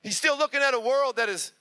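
high, loud, urgent preaching over the band; preaching at full force. Acted. Pastor calling Christians out into the world, full-force preaching. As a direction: build it into a roaring call to the world.

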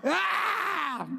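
high, short pretend yelp; playful imitated scream; mock shriek. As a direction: squeal like someone just got hit by a Nerf dart. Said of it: "shot someone in the head".

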